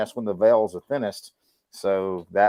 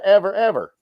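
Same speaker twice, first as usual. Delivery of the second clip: both evers punched high and loud; ever, ever hammered home in disbelief; emphatic ever; 10 semitones higher, 8 dB louder than usual. Real, not acted. Insisting he never fought stoners.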